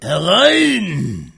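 put-on booming old sea captain; stormy growl. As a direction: call them in like a storm. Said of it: "die sturmige Stimme".

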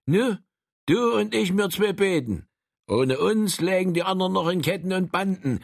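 put-on a proud, self-important old fellow; boastful old man. Frank boasting in dialogue.

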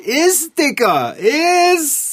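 pushy yell at the cat; urgent shout. Acted. Boy urges the cat to eat, loud and pushy. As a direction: shove him to eat, loud.

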